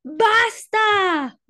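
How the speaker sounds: high, loud fed-up shout; shouting parents. Acted. Parents shouting at Ada to stop, loud. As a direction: yell to stop her at once. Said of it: "sus padres gritaron".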